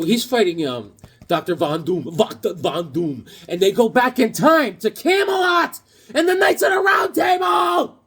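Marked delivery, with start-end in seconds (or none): 3.5-8.0 high, loud, thrilled yell; shouting with comic-book glee; excited shout. Real, not acted. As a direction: blurt the plot out in a rush of excitement. Excited plot summary.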